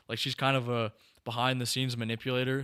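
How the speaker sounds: ordinary delivery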